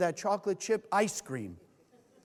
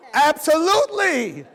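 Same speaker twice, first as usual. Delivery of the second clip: loud, higher, slow gleeful yes; eager yes; 7 semitones higher, 11 dB louder than usual. Retold or imitated. You answering the opponent in your head, eager and loud. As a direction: give in with relish. Giving in to almond horns.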